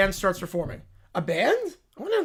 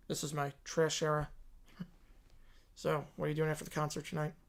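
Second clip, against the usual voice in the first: put-on lower, softer dopey teen voice; Beavis and Butthead; 5 semitones lower, 11 dB quieter than usual. Teens gawking at a concert ad, dopey. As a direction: gawk like a dim teenager.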